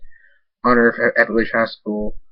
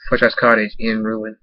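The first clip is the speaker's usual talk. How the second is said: ordinary delivery, nothing marked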